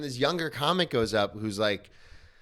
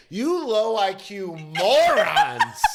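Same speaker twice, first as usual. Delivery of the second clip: higher, slow, louder mock insult; 8 semitones higher, 6 dB louder, much slower than usual. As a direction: drag it out in a jeering higher voice. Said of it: "He's like".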